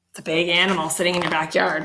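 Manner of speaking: usual speaking voice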